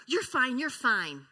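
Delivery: loud brisk reassurance; a parent calling out to a child who fell; dismissive parent. Retold or imitated. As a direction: brush off the crying child. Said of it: "I hear that so much".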